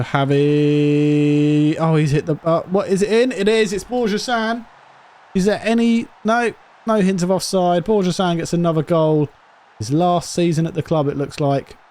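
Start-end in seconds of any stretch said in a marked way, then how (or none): 0.0-9.3 high, loud excited commentary; thrilled commentator shouting the goal; excited goal call. Real, not acted.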